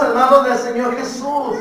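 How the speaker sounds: ordinary talk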